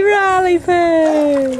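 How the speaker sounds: drawn-out high cheer. Real, not acted. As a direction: call out the pet name slowly and gleefully. Cheering child by name.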